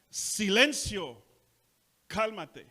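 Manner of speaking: loud, slow rebuke to the sea; commanding rebuke. Acted. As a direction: command the storm. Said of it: "reprendió al viento".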